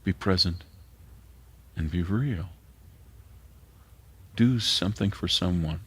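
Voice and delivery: ordinary talk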